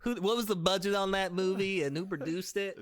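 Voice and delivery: pushing glasses, quizzing like a nerd; film nerd voice. Pretend. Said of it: "As I push my glasses".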